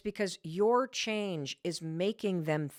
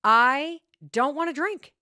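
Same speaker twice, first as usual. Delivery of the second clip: firm, louder frustrated retort; husband fed up with being offered wine; 4 semitones higher, 7 dB louder than usual. Retold or imitated. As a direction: push back, fed up and louder. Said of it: "He looks at me very frustrated".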